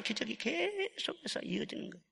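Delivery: an intimate, hushed whisper. Acted. Pastor whispers the promise to the congregation. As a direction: lean in and whisper it like a secret. Sermon point dropped to whisper.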